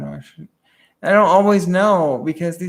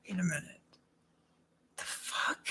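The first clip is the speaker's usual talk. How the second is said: unvoiced, much softer dawning suspicion; whispered realization; 17 dB quieter than usual. Realizing someone is tricking her, she whispers to herself. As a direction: whisper it as the penny drops. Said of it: "I'm like".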